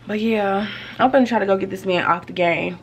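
normal talking voice

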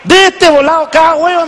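high, eager punchline; excited kid. Retold or imitated. A grandson proudly tells his grandpa the egg size, high and eager.

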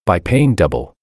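plain reading voice

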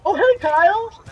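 high, loud greeting; excited greeting. Real, not acted. Greeting Kyle as he turns up in the game, excited greeting. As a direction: call out brightly to a friend.

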